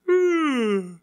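high, stretched yawn through the words; sleepy kid mid-yawn. Acted. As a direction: talk through a waking-up yawn. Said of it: "I don't know why I'm yawning".

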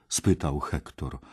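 ordinary delivery

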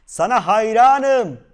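high, very loud, stretched-out call; shouting. A narrator's reading. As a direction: holler admiration across the mountains. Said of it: "diye bağırmış".

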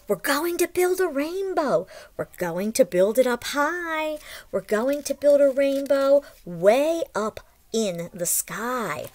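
lively storybook read-aloud; reading to children. A narrator's reading. Teacher reads the story aloud to the class, lively storybook voice. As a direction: read it like a picture book to little ones.

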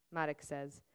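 ordinary delivery, nothing marked

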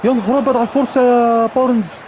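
high, loud stunned exclamation; excited yell. Real, not acted. Gamer cheers his player's move, loud excited shout. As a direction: cry out at the chance. Gamer reacting to missed chance.

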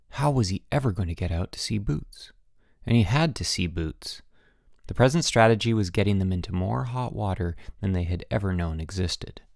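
plain reading voice

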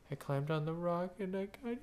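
small, hushed voice imitating a child near tears; mild imitation of welling-up tears; retold tearful confession. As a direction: mimic someone starting to cry, softly. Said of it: "he starts to cry".

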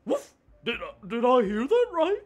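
high, loud barking wolf voice; a Scooby-Doo and Tigger mix; dog wolf voice. Acted. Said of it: "I love your dog wolf voice".

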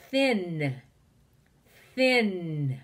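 "Thin" with a long held n; stretched end sound. Shown as a demonstration. Teacher quizzes children on endings, "Thin" with lingering n. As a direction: let the n of "Thin" ring on. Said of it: "sound do you hear at the end".

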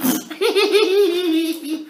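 put-on high, loud childish laugh; cheeky giggle. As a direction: giggle with glee. Alfie laughs again.

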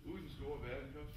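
ordinary talk

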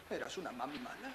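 soft, low laughing taunt; quiet mocking laugh. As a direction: chuckle it cruelly. Son mocks mother.

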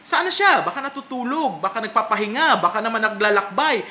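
mock chiding. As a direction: needle them with mocking, sing-out questions. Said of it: "They are chiding the Christians".

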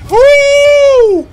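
high, loud victory yell; excited whoop. Real, not acted. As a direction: celebrate like crazy. Big hit in game.

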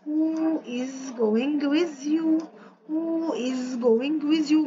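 slow dictation. Shown as a demonstration. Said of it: "مين اللي جاي معاكي".

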